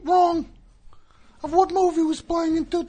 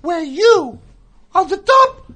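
over a PA system; 5 dB louder than usual. Shown as a demonstration. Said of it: "this is how you do it".

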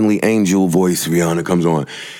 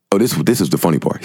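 usual speaking voice

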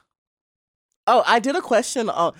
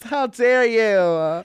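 mock indignation; 3 semitones higher, much slower than usual. Mock betrayal.

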